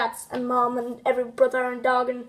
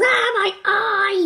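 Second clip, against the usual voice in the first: high, slow mock yelp of pain; pained cartoon wail; 8 semitones higher, 4 dB louder, slower than usual. Joshua losing his eye, high slow mock cry. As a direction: drag it out high like it stings. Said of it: "then it was".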